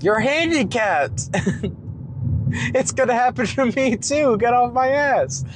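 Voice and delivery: high, frustrated yelling; annoyed at driver. Real, not acted. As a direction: rant at the tailgater.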